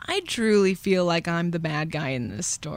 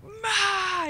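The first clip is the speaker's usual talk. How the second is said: very high-pitched wild mock wail; crazy-mode cry; 14 semitones higher than usual. Angry boy answers the girl telling on him, high crazed cry. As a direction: imitate a kid gone berserk, high. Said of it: "in, like, a crazy mode".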